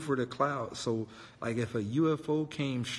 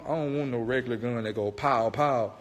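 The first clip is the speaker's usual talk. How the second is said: ordinary talk, nothing marked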